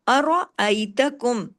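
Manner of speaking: अरअयतकुम slow, heavy रा; slow recitation. Shown as a demonstration. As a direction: give the रा weight. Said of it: "रा को heavy करें".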